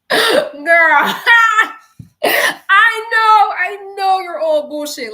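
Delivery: high, loud laughing wail; cracking up into a loud cry. Real, not acted. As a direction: laugh so hard it turns to a cry. Laughing-crying outburst.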